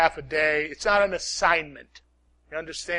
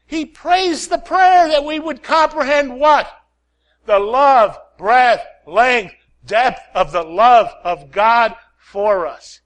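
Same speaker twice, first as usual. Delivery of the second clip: preaching at full force; 4 semitones higher, 6 dB louder than usual. Acted. Preaching climax, rising force.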